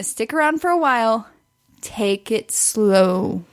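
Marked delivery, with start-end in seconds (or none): 1.8-3.4 drawn out slowly. Acted. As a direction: stretch each word out. Advising men to slow down.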